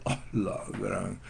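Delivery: whispered, slow Guatemalan oh la gran; Guatemalan exclamation. Retold or imitated. Preacher voicing a Guatemalan's astonished oh la gran. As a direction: breathe it out in Guatemalan amazement. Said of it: "Cuando un chapín dice".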